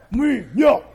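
loud, grunted monosyllables; mock guy talk. Preacher jokes it's a guy thing, mock guy talk.

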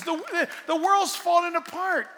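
high, loud mock panic; mock alarmed world. Pastor mimicking the world's panic, high and loud. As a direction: cry it out like a doomsayer, exaggerated. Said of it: "when the world says".

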